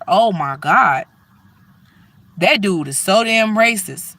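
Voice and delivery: usual speaking voice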